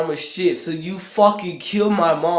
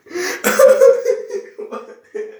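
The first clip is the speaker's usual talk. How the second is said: high squealing laugh; 5 dB louder than usual. Real, not acted.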